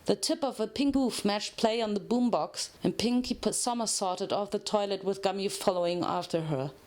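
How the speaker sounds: ordinary delivery, nothing marked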